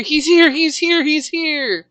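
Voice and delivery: high, fast panicked warning; panicked cry. Acted. Friend warning that the monster has arrived, high and fast. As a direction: blurt the warning in a rush of panic.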